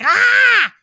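mock frustrated scream at the flat tire in the heat. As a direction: let out the scream you'd been holding in. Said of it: "wanting to be like".

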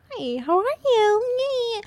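high, drawn-out sugary fake greeting; mock a syrupy customer-service hello, stretched slow and high; phoney high-pitched sweetness. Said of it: "We all have that fake customer voice".

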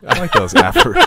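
high, loud words through laughter; laughing. Real, not acted. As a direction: talk while cracking up. Group cracks up over sayings.

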